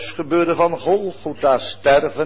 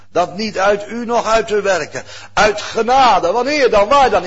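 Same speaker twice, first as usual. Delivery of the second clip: rising, louder preaching; 5 semitones higher, 6 dB louder than usual. Acted. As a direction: press the point home from the pulpit. Preaching at full force. (krachtig preken)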